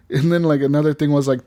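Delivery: ordinary talk, nothing marked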